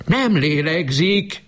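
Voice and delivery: very high, slow, despairing cry; mother crying out that her child isn't breathing. A narrator's reading. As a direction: wail it out in panic. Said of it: "kiáltott most hangosan".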